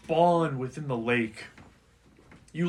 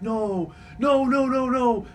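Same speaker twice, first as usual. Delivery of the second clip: high, loud wailing in mock hysterics; hysterical crying; 10 semitones higher, 6 dB louder, slower than usual. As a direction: act out frantic, high-pitched cries of grief. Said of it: "she's just hysterically crying".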